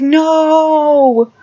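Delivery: high, loud cry of denial; anguished no. Retold or imitated. Luke hearing the father reveal, dramatic cry. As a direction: throw out a dramatic no.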